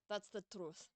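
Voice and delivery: put-on Russian accent. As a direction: voice her as a Russian, understated. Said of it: "I was doing a Russian accent for her".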